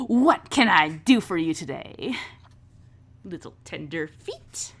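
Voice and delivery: cocky, theatrical boss; swaggering boss. Acted. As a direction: lean back and hold court. Vera leans back, addresses newcomers.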